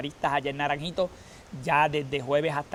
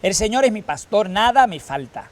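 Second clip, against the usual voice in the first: usual speaking voice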